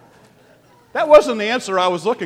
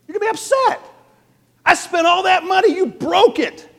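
high, loud mock outrage; acted blow-up; 12 semitones higher, 5 dB louder than usual. Parent upset over the broken vase, acted outburst. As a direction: blow your stack in a high-pitched voice. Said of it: "I'm gonna get upset first".

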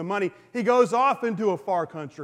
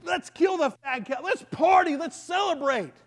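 celebrating father; 8 semitones higher than usual. Retold or imitated. Father orders a feast.

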